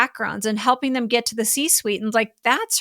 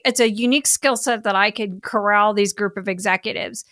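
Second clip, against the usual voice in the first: ordinary delivery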